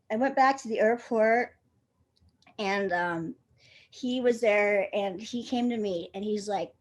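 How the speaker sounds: normal talking voice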